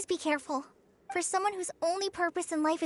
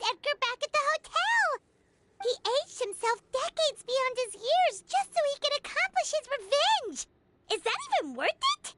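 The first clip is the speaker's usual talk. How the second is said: higher, agitated disbelief; shocked exclamation; 9 semitones higher than usual. Acted. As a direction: blurt it out in stunned outrage. Shocked realization about the revenge.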